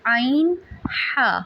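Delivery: عین and ہا eased gently from the mid-throat; soft from the throat. Shown as a demonstration. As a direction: let عین and ہا flow softly, no squeeze. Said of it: "نہایت نرمی سے ادا کرنا ہے".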